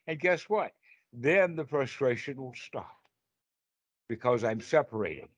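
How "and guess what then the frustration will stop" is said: usual speaking voice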